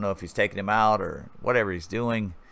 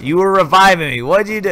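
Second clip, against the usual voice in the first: high, loud imitation of outraged teammate; mock indignant outburst; retold complaint; 8 semitones higher, 7 dB louder than usual. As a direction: demand an explanation as the buddy. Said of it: "Turd's like".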